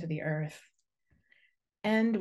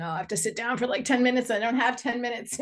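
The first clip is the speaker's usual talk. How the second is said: higher-pitched mock objection; protesting busy person; 6 semitones higher, 4 dB louder, faster than usual. Imagining people who think meditation takes too long, mock protest. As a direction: imitate a meditation sceptic pleading no time. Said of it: "Sometimes people think meditation".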